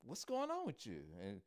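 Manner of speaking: high, soft mock pleading; suspicious coaxing. Man begs her to tell what she knows, high coaxing. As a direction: wheedle it out, pitch climbing. Man coaxes secret from woman.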